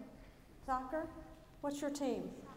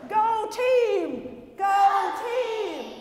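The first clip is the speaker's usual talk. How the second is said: loud high "Go team!" yelled; louder yell; 5 semitones higher, 8 dB louder, much slower than usual. Acted. Pastor pushing kids to yell harder, loud shout. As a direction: belt "Go team!" with more force. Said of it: "Let's try that again".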